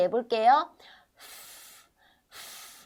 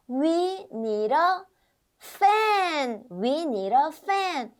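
slow model answer; 5 semitones higher, much slower than usual. Shown as a demonstration. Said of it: "modeling answer slowly".

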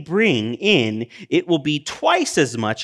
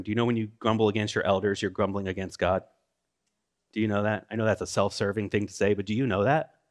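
quiet aside; 4 semitones lower, 7 dB quieter, faster than usual. Real, not acted. Self-deprecating aside to congregation.

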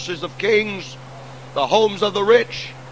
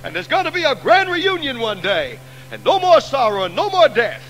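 triumphant revival preacher ringing out; preaching at full force; 3 semitones higher than usual. Acted. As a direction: build the hall to a shout of hope. Triumphant resurrection climax.

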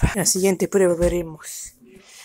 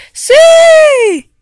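excited; 13 dB louder than usual. Acted. Dogs cheer to start.

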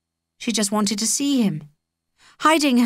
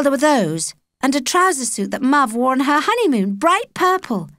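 put-on higher girl's voice, lively; Holly's voice; 6 semitones higher than usual. A narrator's reading. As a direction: play Holly chatting to Jake, higher.

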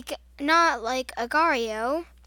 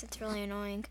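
normal talking voice

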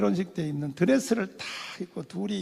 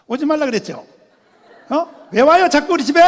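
louder, rising scolding questions; 6 semitones higher, 10 dB louder than usual. Retold or imitated. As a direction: tell him off to his face. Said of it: "집사람이 막 한참 하더니". (따지듯 쏘아붙이는 목소리)

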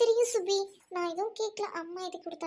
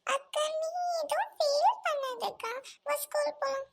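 put-on higher, slow, soft child voice; little sister consoling; 5 semitones higher, 3 dB quieter than usual. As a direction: comfort your big sister gently, like a small kid.

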